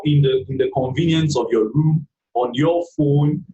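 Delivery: normal talking voice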